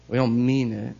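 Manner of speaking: usual speaking voice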